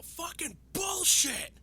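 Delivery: loud, high, drawn-out outburst; re-enacted yell. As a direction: yell it out slowly in outrage. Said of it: "made me yell".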